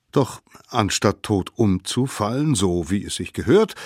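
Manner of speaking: usual speaking voice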